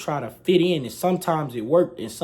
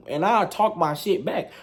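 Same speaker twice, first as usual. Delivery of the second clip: normal talking voice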